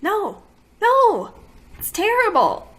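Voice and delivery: high, loud, exasperated rejection; loud, disgusted "no, no". Real, not acted. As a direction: cry out in real dismay. Exasperated verdict on blotting sheets.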